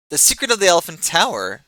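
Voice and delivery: high, loud incredulous exclamation; exclaimed. Acted. Kothian rogue scoffing at the stranger's question, loud and high. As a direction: blurt it out, amazed at the question. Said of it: "he exclaimed".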